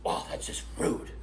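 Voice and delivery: low, hushed imitation of being scandalised; mock offended whisper. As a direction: whisper it as if affronted. Mimics Sue's offended reply.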